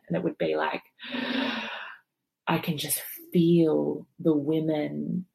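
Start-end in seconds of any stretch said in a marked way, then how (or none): 1.0-1.9 sharp breathless gasp; unvoiced gasp of pain. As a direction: suck in air as the pain hits. Contraction hitting in retelling.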